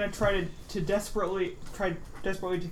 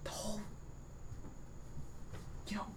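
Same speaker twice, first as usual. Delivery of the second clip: stage whisper; 8 dB quieter than usual. Acted. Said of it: "stage whisper then".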